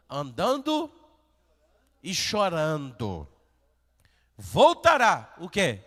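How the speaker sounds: loud, rising sermon build; fiery pulpit preaching; impassioned preaching. Acted. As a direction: preach it out with fire. Preaching at full force.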